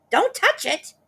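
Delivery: high, loud urgent warning; sharp cry. Acted. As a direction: snap out a warning. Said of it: "cried Missus Twit".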